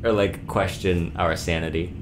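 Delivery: usual speaking voice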